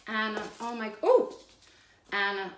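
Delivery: ordinary talk, nothing marked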